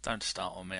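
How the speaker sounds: normal talking voice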